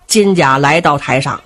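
ordinary delivery

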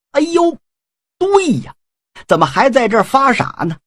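a jolt of sudden realization, voice jumping up. A narrator's reading. Huang Feihu catching the cue from his brothers, surprised.

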